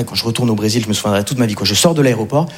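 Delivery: ordinary talk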